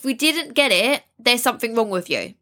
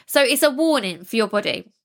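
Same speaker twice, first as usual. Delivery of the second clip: normal talking voice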